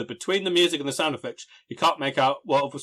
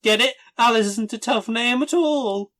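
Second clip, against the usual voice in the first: higher, mocking explanation; sarcastic; 8 semitones higher than usual. Real, not acted. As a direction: spell out the joke with scorn.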